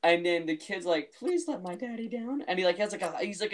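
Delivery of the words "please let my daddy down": put-on high, slow pleading kid in a British accent; a child begging in a British accent; imitating British kid. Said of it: "a British accent".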